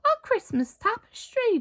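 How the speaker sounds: put-on high, bright child voice; proud announcement. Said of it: "Jonathan announced".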